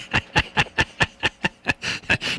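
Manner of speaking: long helpless burst of laughter; real laughing. Real, not acted. Host hearing a joke he already knows, real laughing. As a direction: laugh hard at the punchline. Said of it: "I'm laughing again".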